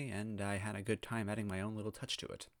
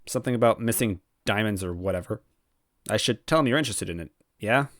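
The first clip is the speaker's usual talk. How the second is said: expressive audiobook narration with character voices; lively storyteller reading a fanfic; story narration; 11 dB louder than usual. A narrator's reading. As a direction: read the fanfic aloud, carrying the listener along. Fanfiction read aloud with voiced characters.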